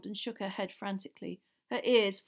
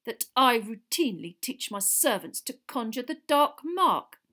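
controlled, growing louder to the last word; cold anger; 4 semitones higher, 8 dB louder than usual. A narrator's reading. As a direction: press the accusation back icily. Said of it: "cold anger in every syllable".

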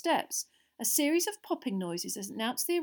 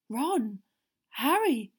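anxious calls, growing higher and louder; shaky; 4 semitones higher, 5 dB louder, much slower than usual. A narrator's reading. Father checking on the children, shaky voice. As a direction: call each name in worry. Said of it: "His voice sounded shaky".

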